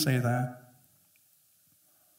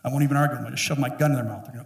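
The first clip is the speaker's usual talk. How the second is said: ordinary delivery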